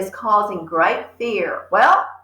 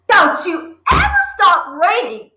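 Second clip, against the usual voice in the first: sharp angry snap, higher; snapped; 9 semitones higher, 5 dB louder than usual. Acted. Father snapping at Matilda over her book. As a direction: bite the words off at her. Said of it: "he snapped at her".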